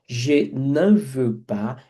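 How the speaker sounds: "Je n'en veux pas" very slow, "n'en" nasal and rising; careful pronunciation. Shown as a demonstration. Teacher models pronunciation for students, very slow. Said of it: "A pronúncia dá mais trabalho".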